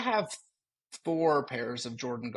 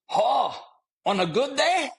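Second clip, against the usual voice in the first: Scottish man; 11 semitones higher, 6 dB louder, slower than usual. Retold or imitated. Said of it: "full Scottish dude".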